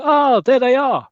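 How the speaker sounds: slow, loud, high cry of recognition; excited fan shout. Retold or imitated. Fans spotting Roy and Blackie again, loud slow high shout. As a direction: yell it like fans spotting their heroes.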